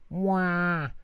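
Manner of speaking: high, hammed-up childish crying wail; mock wail. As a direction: bawl like a cross little kid. Child blames father, wailing.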